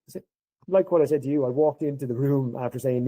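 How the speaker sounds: ordinary talk, nothing marked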